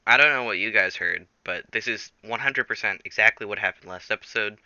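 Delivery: ordinary talk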